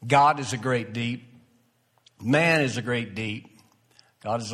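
ordinary talk, nothing marked

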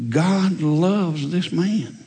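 very slow, hushed realization; slow it right down, tender; slow sincere. Real, not acted. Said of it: "and I realized".

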